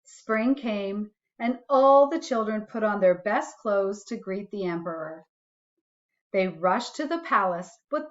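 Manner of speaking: put-on gentle read-aloud for a child; reading a bedtime story to a little one; storybook reading. A narrator's reading. Parent reading a picture book to a child, gentle storytelling.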